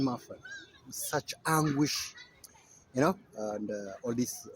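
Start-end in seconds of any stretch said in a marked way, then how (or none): none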